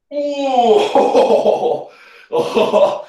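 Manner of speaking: short breathy laugh. Real, not acted. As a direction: laugh off a failed rep. Failed sit-up rep, laughs.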